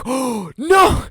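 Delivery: high, loud squealed alarm; retold panic. Patient retelling the moment the nurse caught him, panicked squeal.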